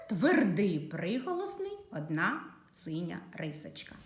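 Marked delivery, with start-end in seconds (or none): none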